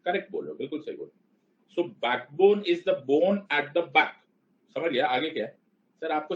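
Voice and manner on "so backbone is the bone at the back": each word drawn out, very slow; mock-serious slow explanation; slow deadpan spelling-out. Shown as a demonstration. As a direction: lay it out word by word like it's obvious. Teacher spelling out a joke.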